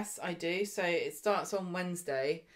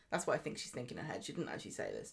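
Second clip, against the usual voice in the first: ordinary talk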